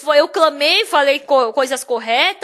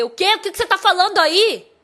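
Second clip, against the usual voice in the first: higher, indignant comeback; angry; 4 semitones higher, 4 dB louder than usual. Retold or imitated. Pastor retelling his roadside retort to the bus driver, angry. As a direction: fire back at an accusation in outrage. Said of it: "Eu fiquei tão brava".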